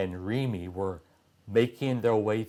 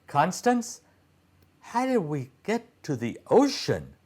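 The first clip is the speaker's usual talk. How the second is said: put-on puzzled boy's voice; 7 semitones higher than usual. Titus voiced high, puzzled.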